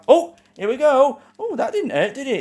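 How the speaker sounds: thrilled it finally comes on; excited. Real, not acted.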